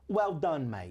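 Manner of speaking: comic English accent lesson. Shown as a demonstration. Comedian showing how to end sentences, English accent. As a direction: teach the catchphrase in mock Cockney. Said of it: "stuck doing an English accent".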